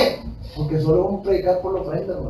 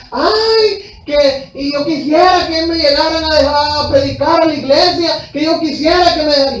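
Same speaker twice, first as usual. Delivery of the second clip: high, wailing mock-crying plea; crying pastors begging to preach, high mock sob; imitating tearful plea; 8 semitones higher, 5 dB louder than usual. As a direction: whine it like someone weeping. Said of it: "todos llorando".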